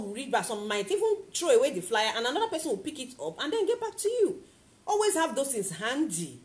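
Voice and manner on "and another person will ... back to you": ordinary delivery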